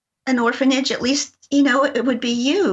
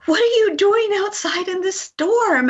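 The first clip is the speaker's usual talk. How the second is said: put-on high, urgent call to the children outside; alarmed scolding; 8 semitones higher than usual. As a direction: demand it sharply, worried for them. Adult scolding kids in storm.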